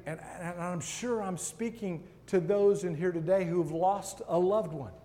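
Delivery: usual speaking voice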